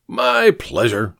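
slow, high, lightly theatrical courtesy; a hint of pompous old man; grandiose old drunkard. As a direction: answer with mild tipsy grandeur. Drunkard answers thanks grandly.

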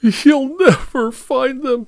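high, slow tearful wail; acted sobbing, words drawn out. A narrator's reading. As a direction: wail it out hopelessly through tears. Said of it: "sobbed Martha".

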